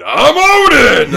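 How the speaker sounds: high, loud, drawn-out hammed-up proclamation; mock triumphant shout. Host mocking the show's obvious Odin reveal, hammed-up triumphant shout. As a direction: bellow the name like a grand reveal.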